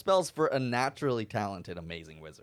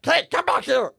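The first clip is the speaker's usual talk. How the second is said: calling out; 8 dB louder than usual. Acted. Character calling after snake.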